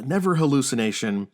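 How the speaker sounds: usual speaking voice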